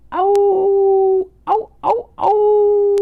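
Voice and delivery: long, high, loud "awoo" howls; sustained falsetto wolf howling. Pretend. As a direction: howl out like a wolf at night.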